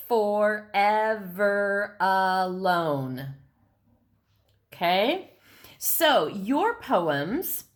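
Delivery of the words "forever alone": "Forever alone" split slowly into five even syllables; "Forever alone" drawn out, for-ev-er-a-lone; counted-out syllables. Shown as a demonstration. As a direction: tap out "Forever alone" one syllable at a time. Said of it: "that was five syllables".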